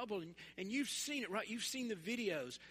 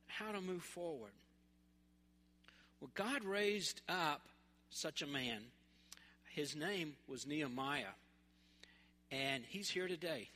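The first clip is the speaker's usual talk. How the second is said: usual speaking voice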